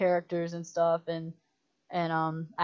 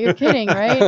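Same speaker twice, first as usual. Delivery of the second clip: loud startled question; shocked disbelief; 4 semitones higher, 13 dB louder than usual. Retold or imitated. Director hearing someone died on the tractor, loud disbelief. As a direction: blurt it out in shock.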